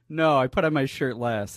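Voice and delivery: usual speaking voice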